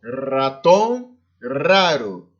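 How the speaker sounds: "ratón, raro" repeated as examples, each R rolled; vibrant trilled R. Shown as a demonstration. Teacher repeating examples for students, vibrant R in "ratón, raro". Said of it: "Sempre vai ter um som vibrante".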